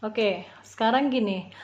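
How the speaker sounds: normal talking voice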